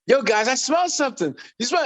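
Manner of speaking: high, playful announcement; teasing catchphrase voice. Retold or imitated. Teasing the guys in the studio as a guest arrives, high mischievous voice. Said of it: "So I go".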